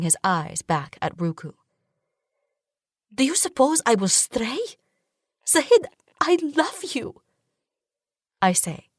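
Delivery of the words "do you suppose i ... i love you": crushed. A narrator's reading. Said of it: "feeling crushed".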